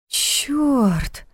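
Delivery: breathy stunned exclamation; astonished gasp. A narrator's reading. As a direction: blurt it out in shock. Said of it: "изумлённо выдохнула Саша".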